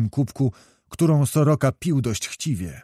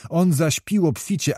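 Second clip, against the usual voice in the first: plain reading voice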